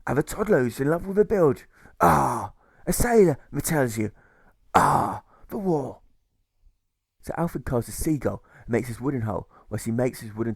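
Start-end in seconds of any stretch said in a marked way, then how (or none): 0.1-6.0 high, loud pirate growl with rolling ah's; hammed-up old sea dog grumbling; salty old pirate, scornful; gruff old pirate jailer.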